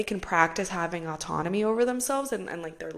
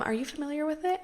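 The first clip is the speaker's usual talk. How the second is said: charming friend; 9 semitones higher, faster than usual. Retold or imitated. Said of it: "try my best to reenact the way".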